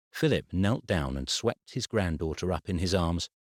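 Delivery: usual speaking voice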